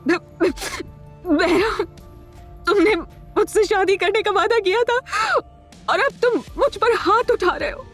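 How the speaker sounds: high-pitched crying, broken by halts; tearful, hurt accusation. Acted. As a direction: accuse him through sobs, voice climbing. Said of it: "उसकी आंखों में मोटे-मोटे आंसू". (रोते हुए, आहत ऊँची आवाज)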